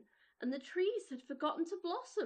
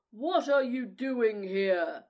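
loud, slow, booming giant; gruff giant voice; 2 semitones lower, 11 dB louder, slower than usual. Acted. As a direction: bellow it like an angry giant. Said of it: "he cried in a very gruff voice".

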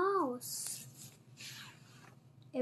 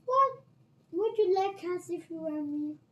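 shrill voice; 5 semitones higher, 6 dB louder than usual. Acted. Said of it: "the mouse said in a shrill voice".

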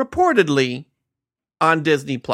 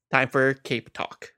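usual speaking voice

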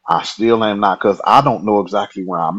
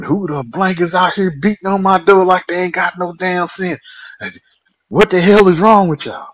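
higher, loud, outraged mother's voice; furious mom; 10 semitones higher, 3 dB louder than usual. Retold or imitated. Herman's mom yells at boys pounding on her door, furious. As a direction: blast them for beating on the door.

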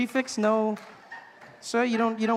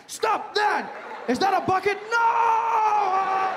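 high alarmed yell; 11 semitones higher, 3 dB louder, much slower than usual. Pretend. America yells at builder.

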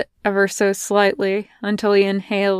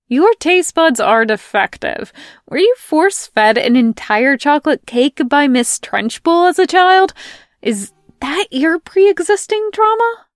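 climbing high and loud in mockery; mock outrage; 7 semitones higher, 6 dB louder than usual. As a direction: tease him more and more. Melanie mocking John's taste.

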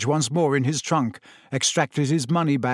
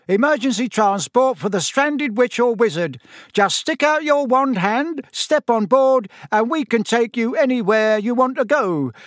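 high, loud conductor's patter; loud announcement; 9 semitones higher, 4 dB louder than usual. A narrator's reading. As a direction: call out like a conductor greeting passengers. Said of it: "began to speak loudly to the night".

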